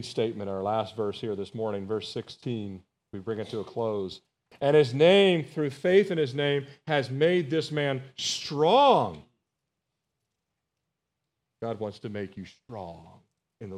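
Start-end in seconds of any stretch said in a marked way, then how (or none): 4.6-9.2 loud opening, drawn-out final word; emphatic scripture reading. Real, not acted. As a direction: proclaim the verse to the congregation. Reads verse aloud emphatically.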